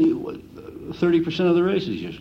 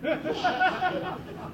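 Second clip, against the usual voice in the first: quick amused laugh, much higher; tickled laugh; 7 dB quieter than usual. Real, not acted. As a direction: laugh at the absurd story. Laughs at the manure tipster story.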